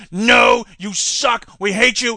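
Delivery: loud, scornful rejection; mock Republicans yelling; hostile shout. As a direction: bark it back at him. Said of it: "Republican leaders in the mid-nineties said".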